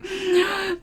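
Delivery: high, helpless laugh; real laughter. Real, not acted. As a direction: dissolve into high-pitched laughter. Cracking up at the joke.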